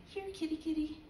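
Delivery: high sing-song kitty call; calling a cat. Acted. Poet mimics a walker calling a cat, high sing-song call.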